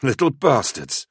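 high, indignant exclamation; exclaimed curse. A narrator's reading. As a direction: burst out with the curse. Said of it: "Cylon exclaimed".

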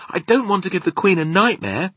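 put-on high, loud little girl voice; little Sophie, upset. A narrator's reading. Sophie refuses the Queen a nightmare, high upset protest.

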